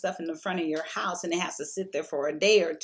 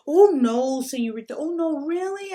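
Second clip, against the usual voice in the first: imitating a Mexican man's broken English, much higher voice; broken English Mexican accent; 8 semitones higher, 6 dB louder than usual. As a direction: put on the Mexican guy's accent, high and eager. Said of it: "he's talking to me in his broken English".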